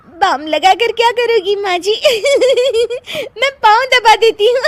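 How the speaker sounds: talking through high giggles; laughing. Acted. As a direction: giggle helplessly through the offer, pitch shooting up. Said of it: "ऊपर से तुम हंस रही हो". (हंसते-हंसते, ऊंची आवाज़ में)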